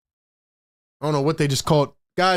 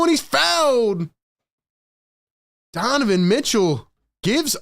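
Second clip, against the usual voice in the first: running game call, rising with the play. Acted. Streamer calling the game for his chat, play-by-play. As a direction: narrate each possession live.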